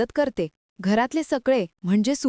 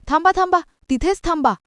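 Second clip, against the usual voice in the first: higher, urgent halt; urgent stop call; 6 semitones higher than usual. A narrator's reading. Woman halting the guest at the door, urgent call. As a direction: stop them in their tracks. Nirupama halts uncle at the door.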